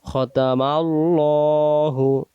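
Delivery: খতামাল্লাহু with full heavy ল, slow; slow recitation. Shown as a demonstration. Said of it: "এমন করে পড়তে হয়". (ভারী লাম দিয়ে ধীরে)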